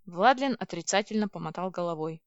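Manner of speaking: usual speaking voice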